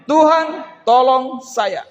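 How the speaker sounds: pleading cry. Shown as a demonstration. Preacher leading the congregation's cry, loud and drawn out. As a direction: cry out slowly for help.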